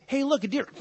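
high, jokey fake distraction; mock trick. Abraham fools his son with a mock distraction, high and jokey. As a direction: play a dad pulling a silly trick. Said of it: "what did Abraham, like, say".

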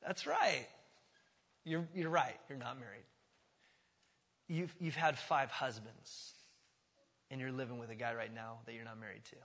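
quiet and tender, no blame; gracious. Retold or imitated. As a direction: lay out the truth kindly. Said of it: "read it through a lens of grace".

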